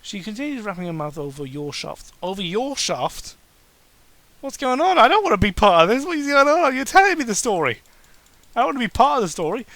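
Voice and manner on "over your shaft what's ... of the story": high, loud, rushed alarm; freaked-out protest. Real, not acted. Reader recoiling from the fanfic's second-person turn. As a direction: back away from this story in panic.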